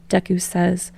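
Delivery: ordinary delivery, nothing marked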